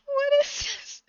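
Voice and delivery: real bewildered laugh-cry; baffled. Real, not acted. Vlogger reacting to the video, baffled laughter. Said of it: "I was just like".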